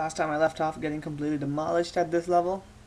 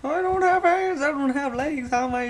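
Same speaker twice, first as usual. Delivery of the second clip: panicked high voice; 7 semitones higher, 5 dB louder than usual. Pretend. Character panics.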